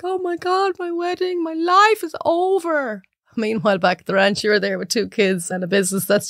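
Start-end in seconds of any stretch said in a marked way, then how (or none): 0.0-3.0 high, slow hammed-up wail; mock wailing bride. Bride cries her wedding is ruined, a high hammed-up mock wail. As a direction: parody a bride whose world has ended. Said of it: "they're crying and they're like".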